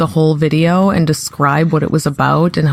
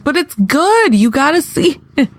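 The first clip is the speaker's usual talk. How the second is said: high, gushing plea; eager mom; 9 semitones higher than usual. Retold or imitated. Mom urging her son to watch a Senate clip, high and eager. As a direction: sell it to your son with big enthusiasm.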